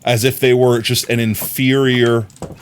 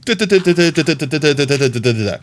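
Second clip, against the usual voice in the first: rapid rattle; 3 semitones higher than usual. Shown as a demonstration. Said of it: "rattling things off".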